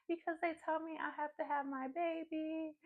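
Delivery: very high imitated wail; hammed-up tearful squeal; mock hysterical crying. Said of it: "crying hysterically and stuff".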